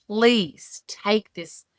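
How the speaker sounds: very slow, pleading prayer to God. Retold or imitated. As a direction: draw out please take this. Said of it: "I'm like".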